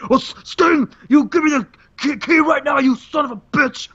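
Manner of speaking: a hammed-up Macho Man rage; mock furious yell. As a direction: demand the key in a hammed-up fury. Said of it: "And just like".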